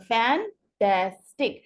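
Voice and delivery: Fantastic broken slowly into three parts; split into syllables. Shown as a demonstration. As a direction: say Fantastic as fan, tas, tic with gaps. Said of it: "तीन parts में divide होगा".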